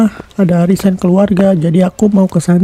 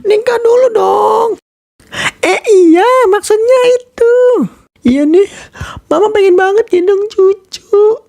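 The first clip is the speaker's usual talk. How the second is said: higher, gushing mother voice; doting mother; 13 semitones higher than usual. Acted. Mother greeting daughter and future son-in-law, gushing. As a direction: fuss over them like a proud mama.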